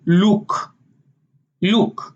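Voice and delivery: "look" as luuk, long tight oo; Israeli look with long oo. Shown as a demonstration. Showing Israeli look with long oo. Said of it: "they would say".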